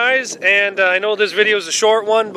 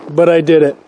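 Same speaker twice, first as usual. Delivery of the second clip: usual speaking voice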